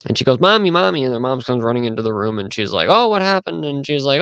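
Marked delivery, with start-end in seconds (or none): 0.4-1.0 put-on high-pitched little girl calling for mom; imitated child calling. Girl calling her mother. 2.9-3.6 high-pitched mock concern; imitated concerned mother. Mother answering child in story.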